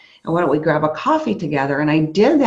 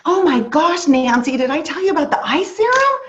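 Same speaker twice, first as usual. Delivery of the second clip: mock excitement; 9 semitones higher than usual. Acts out her own sales pitch.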